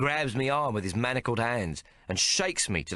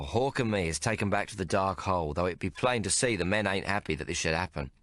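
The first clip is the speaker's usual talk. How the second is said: ordinary delivery, nothing marked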